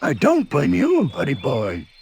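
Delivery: bouncy cartoon tiger voice; lightly voiced bouncy tiger; Tigger voice. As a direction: play Tigger, cocky and breezy.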